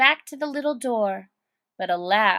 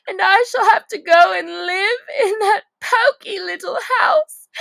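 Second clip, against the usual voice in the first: higher, louder crying voice; tearful; 10 semitones higher, 8 dB louder than usual. Acted. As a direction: wail it through tears. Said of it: "her eyes filled with tears again".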